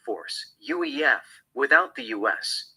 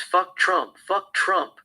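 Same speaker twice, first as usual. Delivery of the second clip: higher-pitched, restrained protest shout; mild shout; 5 semitones higher, slower than usual. A narrator's reading. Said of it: "She shouts".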